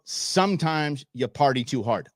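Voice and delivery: ordinary delivery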